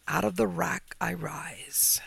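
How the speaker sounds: slow, breathy title whisper. Acted. Story title whispered slowly.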